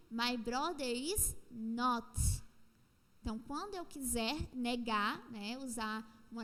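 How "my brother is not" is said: very slow, every word laid out; slow model sentence. Shown as a demonstration. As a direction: spell the sentence out slowly for the class. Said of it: "eu iria empregar aí". (leitura bem lenta, palavra por palavra)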